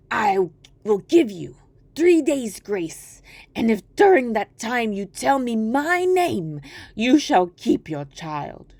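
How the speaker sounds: a strange little man relenting; softened dwarf. Acted. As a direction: grant it grandly, taking your time. Dwarf grants grace.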